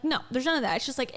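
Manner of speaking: ordinary talk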